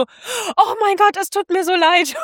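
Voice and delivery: high, slow mock apology; flustered Daphne. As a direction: squeak out a hammed-up sorry. Daphne voiced in retelling.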